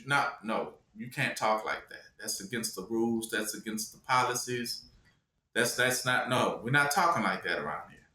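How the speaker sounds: low, softened voice of disapproval; hushed, low mock reprimand; mock official refusal. Acting out officials excluding you.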